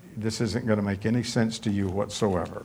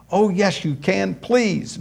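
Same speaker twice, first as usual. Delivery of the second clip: put-on begging boyfriend; 8 semitones higher than usual. Boy begging for a kiss.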